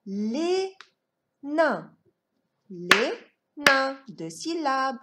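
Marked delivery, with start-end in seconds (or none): 0.1-1.9 "Les nains" split with a long gap; two separate high beats on "Les nains"; syllable by syllable. Shown as a demonstration. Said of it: "On va frapper les syllabes". 2.7-4.0 "Les nains" beaten out in two parts; two firm separate beats on "Les nains"; syllable by syllable. Shown as a demonstration. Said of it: "On va frapper les syllabes".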